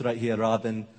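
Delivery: light imitation of a suave father with an Iranian accent; a hint of an Iranian accent, low and smooth; father's Iranian accent. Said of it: "that actually sounds more Russian".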